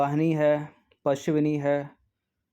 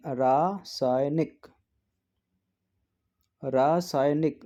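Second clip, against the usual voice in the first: slow; much slower than usual. Shown as a demonstration. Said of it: "correct answer read slowly".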